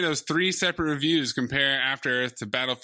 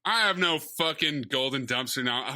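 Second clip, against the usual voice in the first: ordinary delivery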